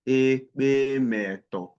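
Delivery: Ekpe Meto drawn out very slowly; very slow demo. Shown as a demonstration. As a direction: stretch each syllable of Ekpe Meto. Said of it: "which is Ekpe Meto".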